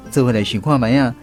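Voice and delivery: ordinary talk, nothing marked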